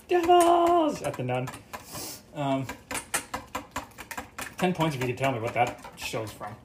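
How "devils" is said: high, loud screech of Devils!; scream. Retold or imitated. The guy screams at the nun, loud high screech. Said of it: "The guy screams".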